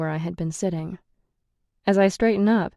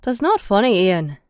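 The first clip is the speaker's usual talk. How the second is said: stern call; 2 semitones higher, 5 dB louder than usual. A narrator's reading. Brennis scolding Ian downstairs, stern and loud. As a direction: scold him down the stairs. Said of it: "calls downstairs sternly".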